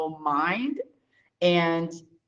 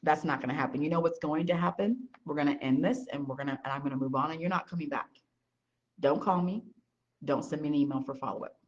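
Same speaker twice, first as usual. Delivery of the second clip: softer, fast, curt brush-off; cold dismissal; 4 semitones lower, 6 dB quieter than usual. Retold or imitated. She dismisses a condescending businessman, soft and curt. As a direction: shut them down flat and quick. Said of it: "when I'm like".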